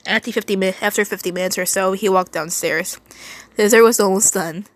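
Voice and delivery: ordinary delivery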